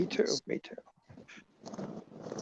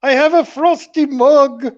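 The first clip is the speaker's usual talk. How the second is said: a hammed-up fake English accent, a bit raised; bad English accent; 9 semitones higher, 5 dB louder than usual. Friend hams a bad English accent on a call. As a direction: play the Brit, badly and proudly. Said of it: "That's a terrible English accent".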